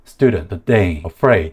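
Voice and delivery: STU in "student", DAY in "the day", FRAID in "afraid" punched; "student, the day, afraid" with weak syllables left light; stressed syllable only. Shown as a demonstration. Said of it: "강세 음절만 신경 써서 발음하는 게 포인트".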